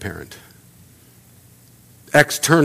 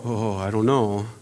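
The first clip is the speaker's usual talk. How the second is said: reluctant mumble; 6 semitones lower, 12 dB quieter than usual. Retold or imitated. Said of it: "And you go".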